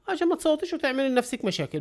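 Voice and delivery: high, hammed-up robber warning; mock thief warning. Robber warns pharmacist not to scream, high mock menace. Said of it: "قال لها".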